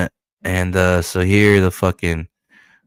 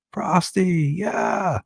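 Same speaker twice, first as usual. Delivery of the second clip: much higher excited whoop; cheering; 12 semitones higher, 4 dB quieter, much slower than usual. Real, not acted. Reader cheering Prosty's transformation, much higher excited whoop. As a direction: cheer him on like a fan.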